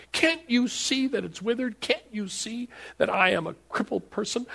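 high, rising, whiny protest; the crippled man protesting to Jesus, high and pleading; pleading complaint. Retold or imitated. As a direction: whine out every excuse. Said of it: "the man could have looked at Jesus and said".

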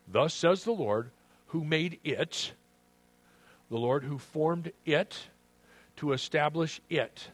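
slow scripture reading leaning on each "it"; "it" set off and emphasized, preacher's proclamation; stress on IT. Acted. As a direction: land firmly on "it" at every phrase end. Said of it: "emphasize the word "it".

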